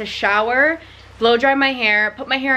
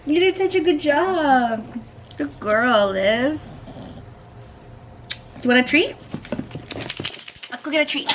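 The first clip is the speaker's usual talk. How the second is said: talking to a dog; 6 semitones higher, 3 dB quieter than usual. Real, not acted. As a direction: coo at her warmly. Praising dog after trim.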